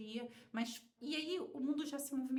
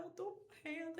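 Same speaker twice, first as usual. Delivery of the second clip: a touch of tearful, higher voice; half-sobbed and quieter, breaking; retold crying; 5 semitones higher, 5 dB quieter than usual. Scene: at her uncle's door, telling him she's dying, tearfully. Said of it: "eu comecei a chorar".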